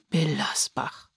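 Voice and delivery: slow, soft, low whisper of the name; disappointed whisper. A narrator's reading. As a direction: breathe the name out in dismay. Enttäuschter Ortsname, geflüstert. (enttäuscht geflüstert)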